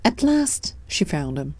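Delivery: usual speaking voice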